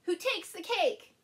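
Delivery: loud, high suspense question; dramatic question. A narrator's reading. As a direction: boom it out to build suspense. Storyteller builds suspense.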